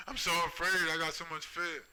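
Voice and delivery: mock them as cowards in a whiny voice; mocking fear. Taunting tough talkers on TV, high mocking whine. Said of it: "You afraid now?".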